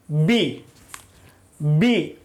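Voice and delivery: "बी" said low, with the lips pressed together first; lips-touch bee. Shown as a demonstration. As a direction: close the lips, then release "बी". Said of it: "बिना lip को सटाए आप बी बोल सकते हैं". (होंठ मिलाकर "बी" बोलिए)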